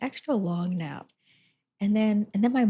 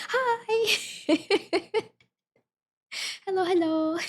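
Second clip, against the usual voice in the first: high squeaky greeting; 12 semitones higher, slower than usual. Retold or imitated. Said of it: "I was like".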